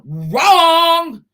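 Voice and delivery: a high, loud shouted "wrong"; furious one-word verdict; loud emphatic wrong. Real, not acted. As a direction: slam the single word "wrong" down hard and high. Ranting at an absent father.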